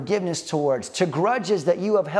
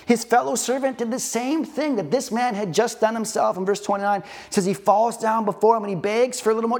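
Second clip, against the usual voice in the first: ordinary talk, nothing marked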